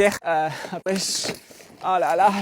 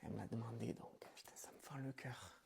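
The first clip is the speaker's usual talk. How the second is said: hushed whisper; whispered aside; 9 semitones lower, 26 dB quieter than usual. Acted. Avant de gifler sa sœur endormie, en chuchotant. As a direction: whisper so she won't wake. Before slapping sleeping sister.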